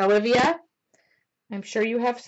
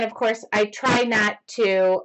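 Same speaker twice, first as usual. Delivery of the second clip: ordinary delivery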